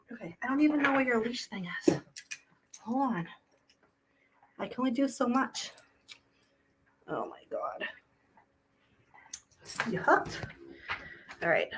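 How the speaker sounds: whisper. Real, not acted. Whispering while fetching leash.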